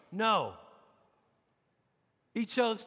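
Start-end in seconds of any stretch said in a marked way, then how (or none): none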